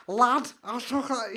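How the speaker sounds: lightly put-on Scouse lad voice, slowed down; Scouse impression. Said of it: "he's just".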